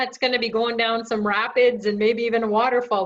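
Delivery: ordinary talk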